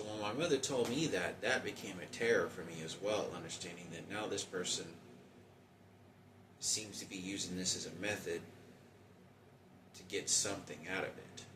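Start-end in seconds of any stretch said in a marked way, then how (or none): none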